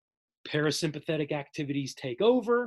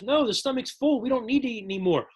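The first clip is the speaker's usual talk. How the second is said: higher, quick and firm report; brisk brain voice; 6 semitones higher than usual. Acted. Hypothalamus telling the body it is full, higher and quick. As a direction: rattle it off like a busy brain.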